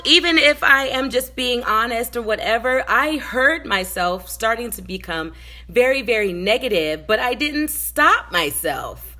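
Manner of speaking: ordinary talk, nothing marked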